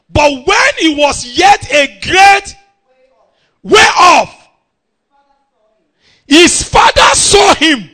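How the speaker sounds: slow, loud, high-pitched scripture reading; proclaimed scripture. Real, not acted. Preacher reading the story to church, proclaimed scripture. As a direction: proclaim each phrase at full voice.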